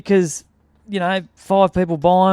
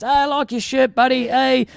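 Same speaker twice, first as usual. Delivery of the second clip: high-pitched passing small talk; acted chummy greeting; 7 semitones higher than usual. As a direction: toss a compliment to a passer-by. Re-enacts small talk with passers-by.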